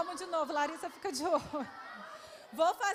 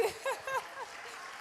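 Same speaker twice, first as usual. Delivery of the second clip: delighted laugh; 6 semitones higher, 5 dB quieter than usual. Real, not acted. Delighted by child's answer.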